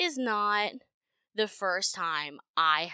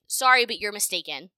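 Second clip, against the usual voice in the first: curt retort; 2 semitones higher, 8 dB louder than usual. Retold or imitated. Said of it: "That was my response to him".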